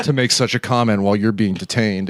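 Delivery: a hint of a low, stern lawman; stern Seagal.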